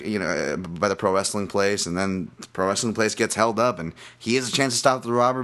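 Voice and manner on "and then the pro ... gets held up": ordinary delivery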